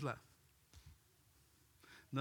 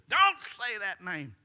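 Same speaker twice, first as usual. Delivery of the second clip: high, loud, drawn-out mock scream; retold curdling scream; 6 semitones higher, 9 dB louder, slower than usual. Witch reacting to Jesus' name, mock scream. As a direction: cry it out loud and slow. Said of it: "a curdling scream".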